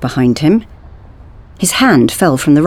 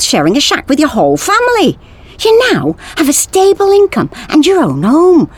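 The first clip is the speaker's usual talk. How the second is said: Sven lecturing; 9 semitones higher than usual. A narrator's reading. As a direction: lecture him with mounting exasperation.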